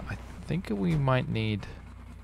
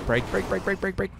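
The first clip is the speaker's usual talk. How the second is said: higher, rapid urgent brake brake; urgent repeats; 8 semitones higher, 3 dB quieter than usual. Real, not acted. Gamer braking hard after the race, urgent. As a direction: hammer the word in a hurry.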